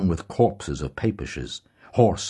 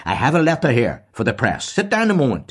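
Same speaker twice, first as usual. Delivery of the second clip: higher, louder instructions; brisk old headmaster; 9 semitones higher, 6 dB louder than usual. A narrator's reading. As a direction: bustle to the desk, ordering him to sit. Deasy asks a favour.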